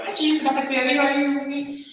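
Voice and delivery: falsete chillón muy agudo; voz aguda exagerada. Shown as a demonstration. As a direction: sube la voz a un falsete ridículo. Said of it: "O hablar". (imita un tono demasiado agudo, en falsete exagerado)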